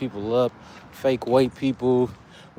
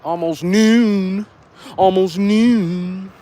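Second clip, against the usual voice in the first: drawn-out emphasis; 7 semitones higher, 7 dB louder, much slower than usual. Real, not acted. Stretching out the time, joking.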